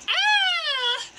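high, loud falling scream. Acted. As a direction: scream as you drop. Girl falling after rope cut.

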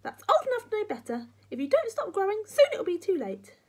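high, loud granny warning; scolding grandma. Acted. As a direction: warn the boy crossly.

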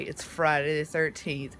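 ordinary talk

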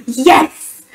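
gasp, then a drawn-out excited yes; gasping mock freak-out; retold freak-out. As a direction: re-enact a delighted fan freak-out. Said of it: "I just had to freak out".

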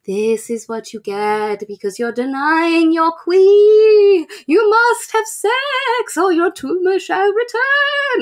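slow, grand mock-royal decree; commanding body voice. Her body scolds Harlow in a slow grand decree. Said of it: "her body's like".

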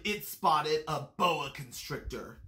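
ordinary delivery

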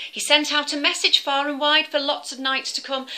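ordinary delivery, nothing marked

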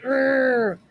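playful frustrated urgh; mock angry growl. Teacher acting mad for her students, playful growl. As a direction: growl like a cross kid. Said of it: "it shows you a picture".

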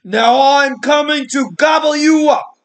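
high, very loud troll shout; troll shouting that he will eat the big goat; roaring troll. Acted. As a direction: bellow the threat like a furious troll. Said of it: "roared the troll".

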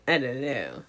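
ordinary talk

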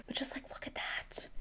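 soft breathy whisper, fading away; awed hush; whispered admiration. Real, not acted. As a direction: lean in and whisper. Admiring the applicator.

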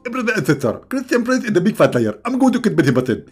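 high, loud mocking caricature; hammed-up high-pitched heckler; mock critic. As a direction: rant in a squeaky put-on complainer's voice. Acted mocking critic of himself.

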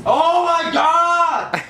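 high, drawn-out cry of frustration; exasperated shout. Real, not acted. Player failing at a game again, exasperated shout. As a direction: wail it slowly in real exasperation.

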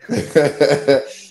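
short real laugh after teasing; amused laugh at the joke. Real, not acted. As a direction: laugh at the jab. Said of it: "Há quem discorde".

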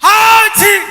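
loud, explosive sneeze; huge sneeze. Retold or imitated. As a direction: let rip a massive village sneeze. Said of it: "ਵੇ, ਕਿਵੇਂ?".

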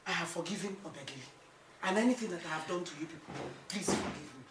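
soft, humble appeal; conciliatory plea. Acted. Mother asking son and wife's forgiveness, soft plea. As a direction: gently ask them to make peace.

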